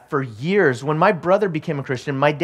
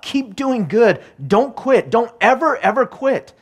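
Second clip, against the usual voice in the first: higher, insistent preacher's exhortation; rallying sermon climax; preaching; 4 semitones higher than usual. Acted. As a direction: build the urge not to give up. Rising exhortation to congregation.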